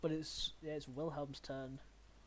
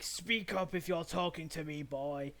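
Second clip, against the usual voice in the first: loud, raised beast voice; confused manticore; 3 semitones higher, 8 dB louder, slower than usual. Acted. Manticore addresses the muttering hero, loud and demanding. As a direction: demand it loudly, a touch higher. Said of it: "the Manticore's, like, confused. It's like".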